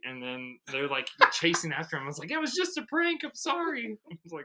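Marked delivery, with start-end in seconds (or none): 2.3-4.0 very high, pleading prankster voice; imitated desperate plea, pitched way up; panicked mock apology. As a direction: squeal an apology like someone being chased. Prankster apologizing while chased.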